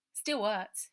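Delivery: put-on a scared street boy pouring it all out; orphan boy Robert. Runaway boy telling Ida his story, loud and urgent.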